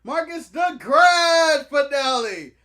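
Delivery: loud, stretched-out ring announcer call; show announcer. Pretend. Co-host announcing himself to open the show, booming announcer. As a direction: draw out your own grand introduction, loudly.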